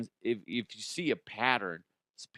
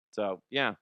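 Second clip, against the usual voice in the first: ordinary delivery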